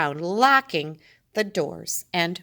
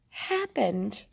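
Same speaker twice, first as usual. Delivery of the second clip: usual speaking voice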